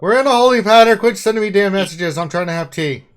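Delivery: high, loud radio shout over the static; shouting soldier. Pretend. Mock radio soldier bit.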